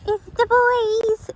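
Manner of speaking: high, slow and excited; excited greeting. Real, not acted. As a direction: call out happily to the horses. Greeting horses in the barn.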